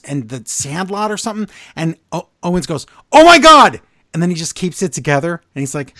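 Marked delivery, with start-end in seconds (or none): 3.1-3.8 much higher and louder "Oh my God", losing it; a sudden, loud, high cry of disbelief; exasperated outburst. Retold or imitated. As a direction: burst out like a man at the end of his rope. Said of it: "Owens almost loses his mind".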